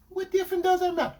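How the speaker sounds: high, quick mimicked protest; defensive girlfriend. Girlfriend dodging his question, imitated defensively. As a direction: imitate her deflecting in a high voice.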